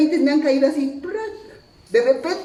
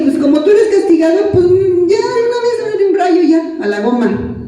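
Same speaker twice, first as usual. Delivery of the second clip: higher, exasperated dare, half in jest; angry at God; 8 semitones higher than usual. Retold or imitated. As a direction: retell a fed-up rant at God. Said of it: "me enojé con Dios".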